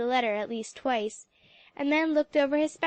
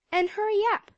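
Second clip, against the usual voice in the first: put-on higher, louder bossy girl; a hint of impatient girl; 9 semitones higher, 4 dB louder than usual. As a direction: hurry her along. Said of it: "said Clem Forsythe".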